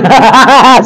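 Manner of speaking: real burst of laughter; amused laugh. Real, not acted. Pastor retelling his wife's complaint, real laughing. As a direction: break into open laughter. Laughing at retold family talk.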